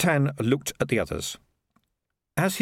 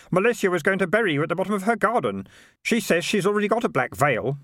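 put-on higher, quick young boy; boy's voice; 8 semitones higher, faster than usual. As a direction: rattle it off like a boy. Keith voiced higher, fast.

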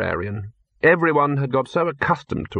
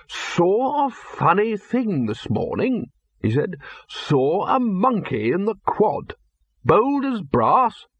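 put-on high, slow, amused old wizard; Arch-Chancellor musing; 8 semitones higher, slower than usual. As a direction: tell a curious tale with relish. Arch-Chancellor recalls a monkey.

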